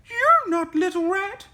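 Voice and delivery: put-on high, loud old granny yell. A narrator's reading. Granny finding the wolf at her door, high yell. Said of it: "yelled Granny".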